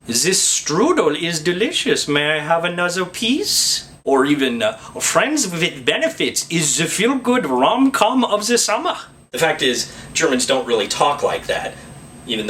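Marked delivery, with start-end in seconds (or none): 0.1-3.9 slow, exaggerated movie-Nazi German accent; Hollywood German villain accent. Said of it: "they always talk like this". 5.0-9.0 put-on higher, slow, hammy German accent; Hollywood German villain accent. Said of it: "they always talk like this".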